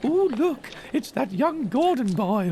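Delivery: put-on high old Scottish lady voice; old lady Scottish accent. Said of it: "she's doing it in a Scottish accent".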